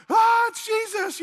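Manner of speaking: hammed-up yelp of fright at the sight of Jesus; startled scream. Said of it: "And they're like".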